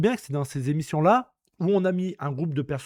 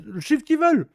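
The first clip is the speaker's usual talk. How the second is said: ordinary talk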